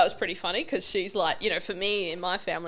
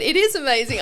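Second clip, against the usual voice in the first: excited; 5 semitones higher, 5 dB louder than usual. Retold or imitated. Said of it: "And she said".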